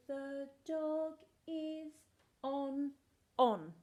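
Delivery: drawn-out reading aloud; very slow reading. Shown as a demonstration. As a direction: stretch each word very slowly. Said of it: "very slow reading".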